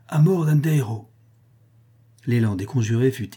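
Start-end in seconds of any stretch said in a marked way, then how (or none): 0.1-1.1 higher-pitched, moderately raised rallying cry; understated battle cry, pitch lifted; crowd's cry. A narrator's reading. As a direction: call it out like conspirators demanding a death. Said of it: "au cri de".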